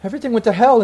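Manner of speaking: high, loud anguished explanation; crying in disbelief. Acted. Ex-girlfriend recalling the disaster, in acted high distress. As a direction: push it out, overwrought. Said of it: "And she's just crying".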